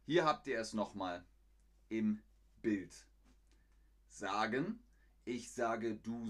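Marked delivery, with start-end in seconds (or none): none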